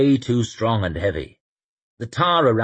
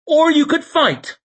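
blunt woman's voice; 6 dB louder than usual. Acted. Shai offers knife.

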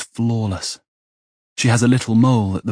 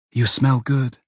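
higher, musing woman's voice; lightly voiced, higher private remark; as if to herself; 5 semitones higher than usual. A narrator's reading. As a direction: lift the pitch, as if thinking aloud. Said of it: "as if to herself".

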